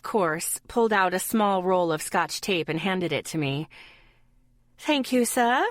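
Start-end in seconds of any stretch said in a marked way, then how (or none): 4.8-5.6 higher-pitched fake British accent. A narrator's reading. Girl thanking friend for tape in fake British accent. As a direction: put on a playful British accent. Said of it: "in my fake British accent".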